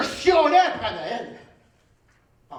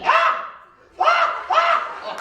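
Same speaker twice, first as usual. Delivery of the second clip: shrieking laugh; 6 dB louder, much slower than usual. Acted.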